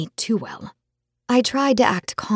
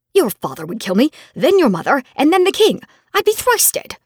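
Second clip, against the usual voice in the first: building from level to much higher and louder; rising panic; 7 semitones higher, 5 dB louder than usual. Acted. Friend listing who would kill him, rising panicky outburst. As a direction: climb into near-shrieking alarm. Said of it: "he exclaimed".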